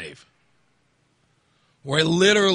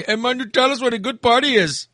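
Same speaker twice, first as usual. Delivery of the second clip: high-pitched imitated Indian accent; weird Indian accent; 8 semitones higher than usual. Indian passenger asking driver about parties, imitated Indian accent. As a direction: mimic an Indian party guy's accent. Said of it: "It was the weirdest accent ever".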